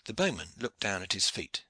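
ordinary delivery, nothing marked

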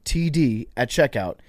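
brisk sponsor read to listeners. Acted. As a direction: sell the promo clearly. Host reads sponsor ad.

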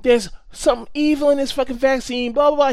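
high, loud imitated rant; mock yelling. Retelling the conspiracy guy yelling at everyone, mock rant. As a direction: ham up the guy yelling in the station. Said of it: "He's, like, yelling".

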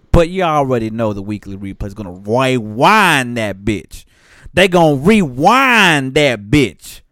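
loud hype-man delivery. Acted. Hype intro build.

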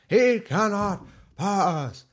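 Gandalf voice. Retold or imitated. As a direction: pronounce it like a wizard barring the way. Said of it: "what Gandalf did".

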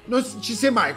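ordinary talk, nothing marked

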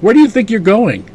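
high, loud demanding question; indignant challenge. Retold or imitated. Leia stopping Boba Fett from leaving, a high loud demand. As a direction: call him out, outraged. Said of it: "Leia's just like".

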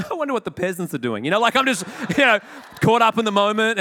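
mock snob. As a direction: play the smug lounge snob.

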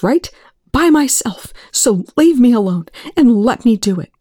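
loud, high and raw; angry shouting. A narrator's reading. Eli furiously telling Young to leave him alone. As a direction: push him away with every word.

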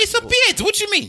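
very high-pitched, incredulous objection; indignant protest. Real, not acted. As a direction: protest it in a high squeal of disbelief. Protesting friend's beard being dismissed.

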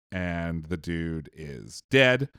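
ordinary delivery

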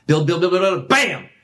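ramp it up to a punch; building up. Shown as a demonstration. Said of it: "you see me ramping up".

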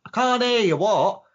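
imitating a high-pitched shouted exchange; mock calling out. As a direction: pretend you can't hear through glass. Acted exchange through a shut door.

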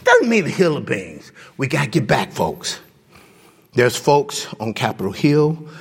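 normal talking voice

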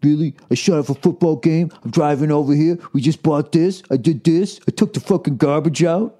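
imitating your own childish protest; whiny baby. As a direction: list your grievances like a sulking baby. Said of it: "I was a baby about it".

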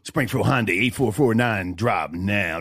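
low, slow radio announcer read. Shown as a demonstration. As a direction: say it like a radio ad, slowly. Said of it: "That's my radio voice".

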